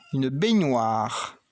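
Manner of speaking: une baignoire said slowly and clearly; slow naming. Shown as a demonstration. Teacher to child, slow clear baignoire. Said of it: "Je monte mon B".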